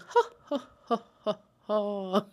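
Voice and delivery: spaced-out "ha"s trailing off; imitated jolly laugh. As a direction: mimic a man chuckling as he walks away. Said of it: "he walks off laughing".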